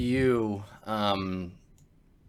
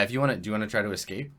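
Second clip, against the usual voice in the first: ordinary talk, nothing marked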